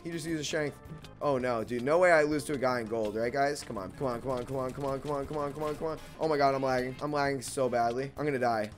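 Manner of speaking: ordinary talk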